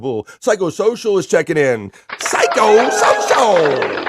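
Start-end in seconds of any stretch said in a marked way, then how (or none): 2.2-4.1 drawn-out announcer call. Acted. Host welcoming a chatter by name, announcer call. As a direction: stretch the name very slow, louder and higher.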